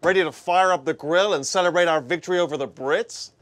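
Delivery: put-on bright, overdone American accent; a Brit putting on an American voice; fake American accent. A Brit hamming up American accent for Americans. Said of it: "despite that incredibly convincing American accent".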